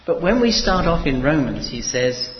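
loud, driving sermon delivery; full-force preaching. Acted. Preacher to congregation, loud fervent preaching. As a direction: proclaim it to the whole hall.

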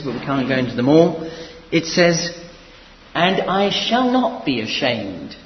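loud, driving sermon delivery; full-force preaching. Acted. Preacher to congregation, loud fervent preaching. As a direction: proclaim it to the whole hall.

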